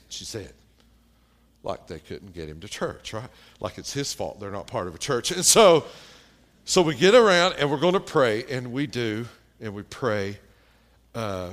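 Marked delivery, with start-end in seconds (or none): none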